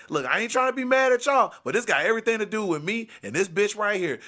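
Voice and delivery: high-pitched heated protest; still losing it. Retold or imitated. Boyfriend explaining himself to departing men, heated and high. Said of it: "still losing it".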